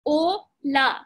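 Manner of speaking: "O, la" as two separate syllables; split into syllables. Shown as a demonstration. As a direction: break it apart: "O", pause, "la". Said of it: "break it down into two parts".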